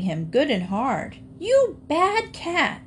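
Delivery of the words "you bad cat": put-on high, slow, scolding child's cry; a small girl scolding the cat who took her dolls; little girl crying out. A narrator's reading. Little girl angrily scolding the bad cat. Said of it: "she cried".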